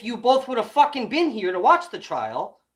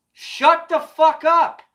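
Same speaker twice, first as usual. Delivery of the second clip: louder, very slow, each word spaced; slow shouted command; 6 semitones higher, 6 dB louder, much slower than usual. Real, not acted. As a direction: drag it out like a final warning. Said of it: "repeated command".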